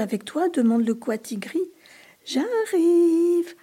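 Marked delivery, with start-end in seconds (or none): 2.2-3.5 high, loud distant call; calling from afar. A narrator's reading. Seeker calling from the woods, loud distant shout. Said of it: "entend-on à la lisière du bois".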